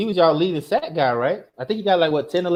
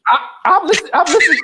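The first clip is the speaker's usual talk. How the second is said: high laughing protest, caught out; 10 semitones higher, 3 dB louder, faster than usual. Real, not acted. Scolded by his cohost, he laughs out his defence. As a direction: squeal it through the laughter.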